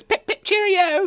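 loud, very high falsetto queen; piercing mock-regal falsetto; queen impression. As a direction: trill it slowly as a cartoon British queen. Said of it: "And then the queen says".

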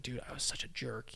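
whispering the remorse to himself; a guilty whisper to himself; whispered regret. Retold or imitated. Missionary realizes his old cruelty, whispering in regret.

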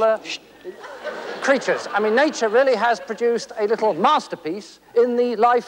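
usual speaking voice

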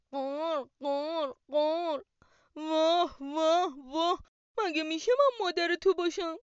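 loud cawing crow voice, scoffing. Acted. Crow mocks the chick's question, loud cawing voice. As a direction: caw and scoff like a rude crow. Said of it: "کلاغ گفت".

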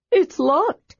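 high, bright kender. A narrator's reading. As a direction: chipper kender report. Tass reports.

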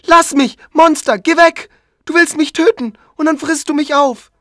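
put-on high, loud terrified child's scream; child screaming at the monster. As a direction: shriek in panic like a little boy. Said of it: "Es schreit wieder".

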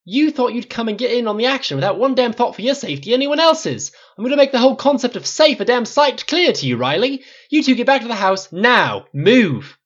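very high, loud furious shouting; explode, then bark the final order; detonating rage. A narrator's reading. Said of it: "flinched as Flynn detonated".

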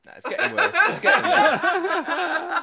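high laughing complaint. Real, not acted. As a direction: laugh through the words. Laughing at the bad impression.

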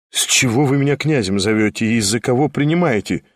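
plain reading voice

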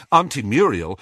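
ordinary delivery, nothing marked

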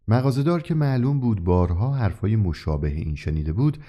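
ordinary delivery

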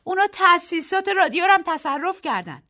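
high, loud panicked cry; terrified shout. A narrator's reading. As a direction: scream the news in terror. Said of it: "وحشت زده فریاد زد".

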